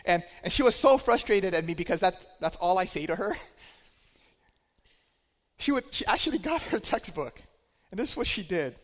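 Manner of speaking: ordinary talk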